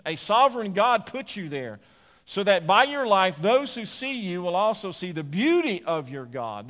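preaching at full force. Acted. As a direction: drive it home like a preacher building to a call. Preaches the application.

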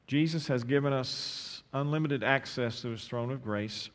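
ordinary delivery

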